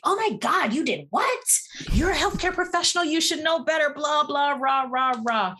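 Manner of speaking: high, fast mock-scandalized scold; judgmental people. Guest imitates people scolding her for her home birth, mock-scandalized. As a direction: mimic the critics tutting at her, quickly. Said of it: "most people are probably like".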